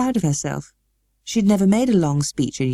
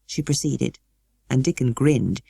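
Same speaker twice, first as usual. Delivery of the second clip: plain reading voice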